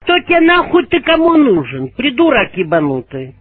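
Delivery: ordinary delivery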